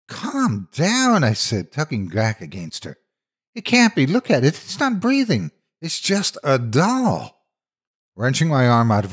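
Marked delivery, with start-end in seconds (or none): 0.1-1.2 high, tense plea to calm down; frightened boy trying to calm his friend; urgent pleading. A narrator's reading. Narrator pleads with panicking friend. 3.6-7.5 put-on scared boy insisting to his friend it's only a doll; nervous reassurance. A narrator's reading. Narrator reassures terrified friend.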